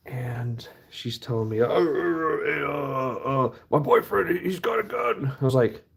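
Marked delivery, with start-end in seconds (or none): 1.6-5.4 stammering and climbing higher, mock scared; half-hearted imitation of her nervous babble; imitating a panicked stammer. Retelling the frightened girl at his door, mock stammering panic.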